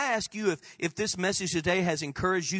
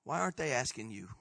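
low, soft pointed question; hushed, lowered, searching question; quiet challenge; 6 semitones lower, 6 dB quieter than usual. Real, not acted. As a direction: turn the question softly on the listener. Turns question quietly on congregation.

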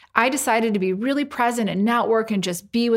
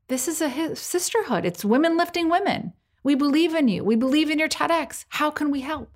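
high-pitched quote of encouraging friends; supportive friends; 6 semitones higher than usual. Retold or imitated. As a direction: voice the friends' eager reassurance, pitched well up. Voices friends' supportive answers.

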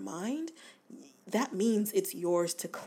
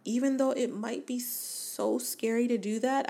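ordinary talk

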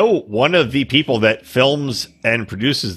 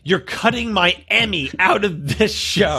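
higher, slow, emphatic mock protest; outraged producer; 6 semitones higher than usual. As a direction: plead for your Emmy, hammed up. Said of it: "Can you imagine the producer is like".

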